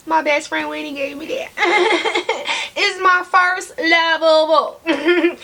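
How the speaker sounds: slow words broken by real laughter; laughing. Real, not acted. Vlogger showing viewers her gift doll, laughing. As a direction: laugh through it, delighted.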